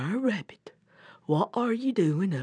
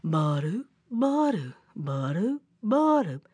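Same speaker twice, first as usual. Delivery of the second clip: sauntering footstep noises. Acted. Old bear saunters down the road, seesawing footstep sounds. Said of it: "So he kept on walking".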